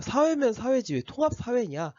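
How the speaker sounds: ordinary talk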